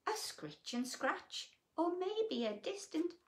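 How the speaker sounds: lilting storybook read-aloud; reading to children. A narrator's reading. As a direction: read it like a bedtime picture book. Reading picture book aloud.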